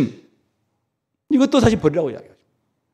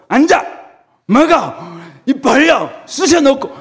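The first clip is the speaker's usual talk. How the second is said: loud, higher barked orders; mock bossy shouting; 8 semitones higher, 5 dB louder, slower than usual. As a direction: bark commands like a forceful feeder. Forceful God parodied. (억지로 먹이는 사람처럼 소리치기)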